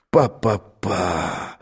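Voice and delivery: slow, low, drawn-out "pa-pa-pa". Acted. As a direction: stretch out "pa-pa-pa", scared. Terrified character muttering. (медленное низкое «па-па-па»)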